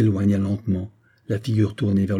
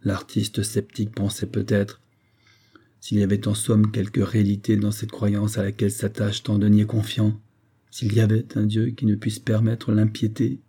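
usual speaking voice

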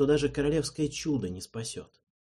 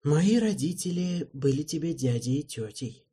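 sighed; 4 semitones higher than usual. A narrator's reading. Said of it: "вздохнул Эральд".

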